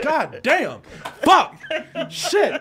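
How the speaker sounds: loud cursing burst; angry outburst. Retold or imitated. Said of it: "and I'm like".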